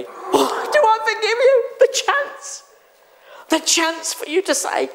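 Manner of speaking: God can't believe you even asked; astonished God. Acted. Preacher voices God answering a sinner, delighted surprise.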